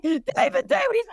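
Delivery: acted-out frantic attempt to rouse someone; urgent wake-up call. Wife calling her sleeping husband, urgent. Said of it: "I'm like".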